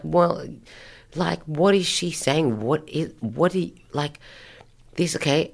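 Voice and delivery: high, halting, stammering bewilderment; baffled. Retold or imitated. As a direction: act lost for words, starting and breaking off. Re-enacts her own bafflement.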